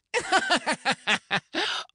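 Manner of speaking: a high, ringing supervillain cackle; villain laugh. A narrator's reading. Villain laughing at his captive, high cackle. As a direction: throw the head back and cackle high. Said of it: "tilted his head back and laughed".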